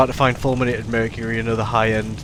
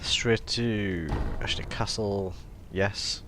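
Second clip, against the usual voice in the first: whispered aside; 3 semitones lower, 7 dB quieter, much slower than usual. Real, not acted. Muttered aside to self.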